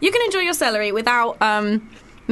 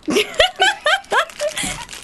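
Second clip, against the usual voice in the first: shrieking giggle; high squeal of laughter. Real, not acted. Laughs at chewing noise.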